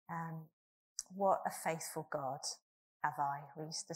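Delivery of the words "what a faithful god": usual speaking voice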